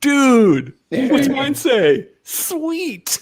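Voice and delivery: high, drawn-out stoner-comedy exchange; goofy movie dialogue. Acted. Quoting the tattoo bit to co-hosts, mock excited. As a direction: play both sides of the silly back-and-forth.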